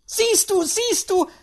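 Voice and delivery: very high, loud gloating; triumphant cry. A narrator's reading. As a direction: crow with delight as an old lady. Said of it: "rief die alte Dame strahlend und zufrieden".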